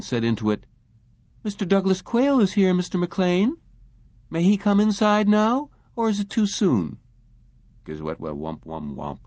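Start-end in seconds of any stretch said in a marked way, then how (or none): 1.4-7.0 higher, polite receptionist voice; receptionist on the phone. A narrator's reading. Receptionist voice on vidphone. 7.8-9.2 low, soft, distant garble through a phone; garbled voice over the line; muffled phone mumble. A narrator's reading. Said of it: "the phone mumbled".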